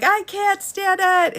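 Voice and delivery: high, drawn-out wail of frustration; exasperated complaint. Retold or imitated. Fussing to her oblivious husband about her hair, mock exasperated. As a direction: whine it like you're fed up. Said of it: "And I'm like".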